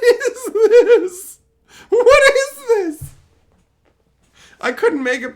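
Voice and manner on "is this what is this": high, loud, slow shriek of disbelief; shrieking laughing disbelief. Real, not acted. Reviewing the show, he shrieks in laughing disbelief. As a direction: cry out what is this through laughter.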